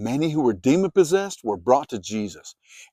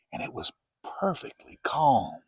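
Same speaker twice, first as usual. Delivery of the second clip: hushed whisper; 7 dB quieter than usual. A narrator's reading. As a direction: whisper it, all still. Storm suddenly stilled.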